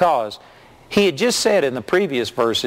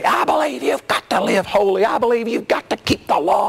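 imitated legalist; 7 semitones higher than usual. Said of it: "I've heard people before say".

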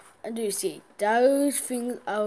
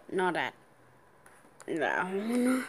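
ordinary talk, nothing marked

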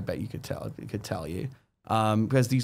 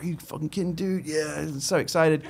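excited Kevin; 4 semitones higher, 4 dB quieter than usual. Retold or imitated. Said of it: "he's like".

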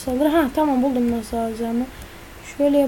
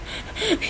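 breathy laugh; 7 semitones higher, 10 dB quieter than usual. Real, not acted. Laughing after dying in game.

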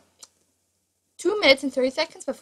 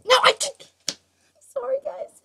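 high squeal; 12 semitones higher than usual. Pretend.

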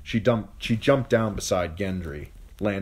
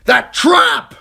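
high, full-force scream; 15 dB louder than usual. A narrator's reading. Yoren pointing out the escape mid-battle, screaming. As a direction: scream it as an urgent direction. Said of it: "he screamed".

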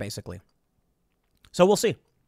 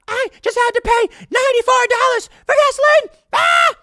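shrill high shrieking rant about gas; mock screaming woman; 6 dB louder than usual. Mocking the viral woman screaming in her car, hammed-up shriek. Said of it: "this woman in her car screaming".

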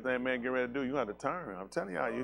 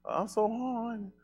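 whiny voice; 9 semitones higher, slower than usual. Retold or imitated. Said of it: "Can't say horny".

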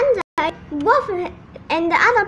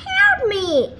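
high cry for help; raised, pleading cry; 6 semitones higher, 4 dB louder than usual. A narrator's reading. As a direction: call out for help, pitched up. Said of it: "Wolf cried".

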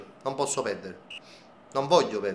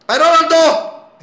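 shouting; 11 semitones higher, 9 dB louder, slower than usual. Real, not acted. Cheering on his player.

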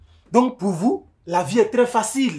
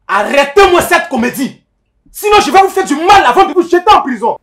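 angry shouting; 11 semitones higher, 12 dB louder than usual. Acted. Commissioner threatens quarrelling pair.

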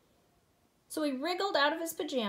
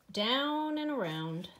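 ordinary delivery

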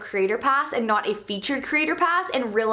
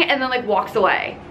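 ordinary talk, nothing marked

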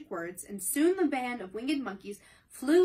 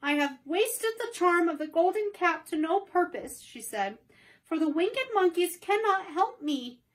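put-on higher, louder dismayed girl; disappointed; 7 semitones higher, 4 dB louder than usual. Dorothy after the monkeys refuse, higher disappointed voice. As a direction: near tears with disappointment. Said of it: "almost ready to cry with disappointment".

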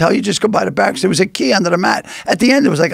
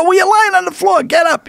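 mock teasing; 11 semitones higher than usual. Lenny telling Sweeney on the floor to get up, high mock teasing. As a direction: laugh at him to get up.

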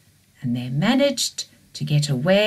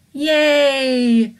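excited cheer; 7 semitones higher, 7 dB louder, slower than usual. Acted. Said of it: "they said".

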